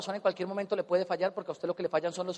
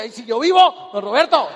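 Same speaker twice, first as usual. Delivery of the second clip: loud playful mock sympathy; mock concern for the old man, louder; teasing mock; 10 dB louder than usual. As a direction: tease him, loudly.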